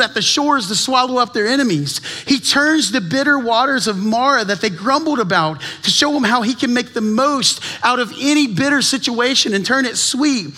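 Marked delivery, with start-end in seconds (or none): none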